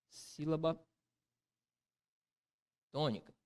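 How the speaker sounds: soft words, a long pause between; slow writing-along. Real, not acted. Teacher writing the term on the board, soft and halting. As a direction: say it quietly as you write it.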